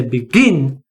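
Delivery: be-GIN, the second syllable stronger; stress on final syllable. Shown as a demonstration. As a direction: begin with GIN punched. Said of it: "stress is on the final syllable".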